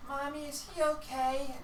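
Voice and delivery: put-on high slow small child voice; worried child. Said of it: "you hear one kid go".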